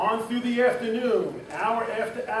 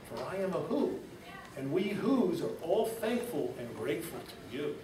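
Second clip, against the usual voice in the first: softer, small far-off voice; whisper-thin little mayor; faint tiny voice; 5 dB quieter than usual. Acted. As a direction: speak as someone too small to be seen. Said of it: "The voice was so faint".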